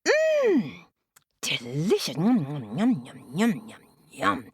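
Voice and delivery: munch it up with relish; delighted munching. Acted. Tina tastes her first banana, delighted munching.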